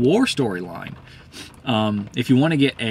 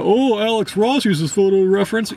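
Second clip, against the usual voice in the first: higher-pitched mock-defender voice; mocking objector; 7 semitones higher, 3 dB louder than usual. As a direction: pitch it up, mimicking someone making excuses. Mimics defenders citing a famous artist.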